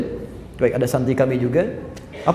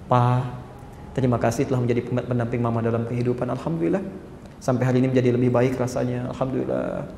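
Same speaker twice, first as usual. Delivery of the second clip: much softer, lower, tender thanks; gentle wife; 5 semitones lower, 8 dB quieter than usual. Shown as a demonstration. Preacher models a wife gently thanking her husband, low and soft. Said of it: "masuk dengan kelembutan".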